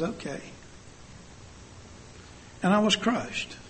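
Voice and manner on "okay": very soft, small reply; quietly retold meek agreement; teary giving-in. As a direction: imitate a tearful child giving in. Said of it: "looked at me with his teary eyes".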